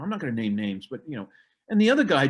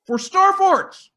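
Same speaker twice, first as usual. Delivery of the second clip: pitched way up, louder and slow; mock amazement at the irony; incredulous; 8 dB louder, much slower than usual. Real, not acted. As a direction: stretch it out in disbelief. Incredulous about a star fort website.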